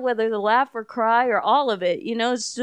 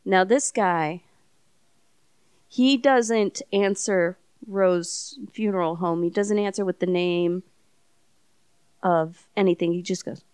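ordinary delivery

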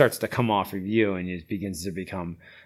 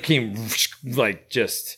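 ordinary delivery

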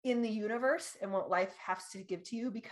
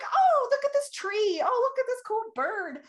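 much higher and louder, gasping with wonder; squealing at a tree and a bird; delighted gushing; 7 dB louder than usual. Retold or imitated. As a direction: gush over a tree like a kid. Retelling her excitement on walks.

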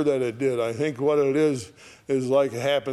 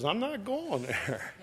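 talking donkey; 7 semitones higher, 7 dB quieter than usual. Acted. Donkey talks back.

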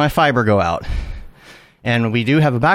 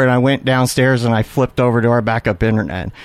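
usual speaking voice

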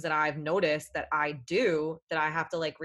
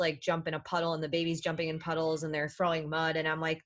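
ordinary talk

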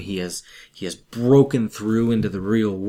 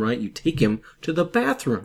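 usual speaking voice

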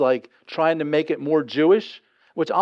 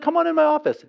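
lightly voiced judge, much higher and bright-sounding with welcome; judge's welcome; 11 semitones higher, faster than usual. Preacher voicing the judge inviting him into her office, a lightly higher welcoming voice. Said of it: "She said".

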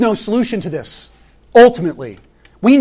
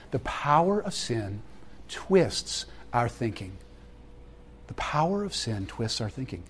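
hushed emphasis; 6 semitones lower, 13 dB quieter than usual. Real, not acted. Repeated line, dropped voice.

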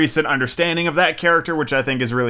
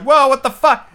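high loud mock disbelief; stunned outburst; 11 semitones higher, 6 dB louder than usual. Everyone reacting to Talia's killing, loud stunned yelp. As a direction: yelp it like the whole room gasped. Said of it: "everybody's just like".

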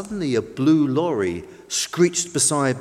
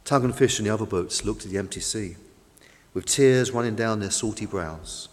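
sad; 7 semitones lower, 6 dB quieter than usual. A narrator's reading. Sad narration after loss.

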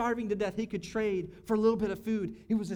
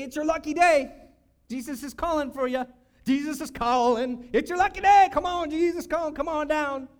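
raised, excited crowd shout; calling out; 5 semitones higher, 7 dB louder than usual. Retold or imitated. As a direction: holler it like summoning a lucky winner. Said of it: "They called out to him".

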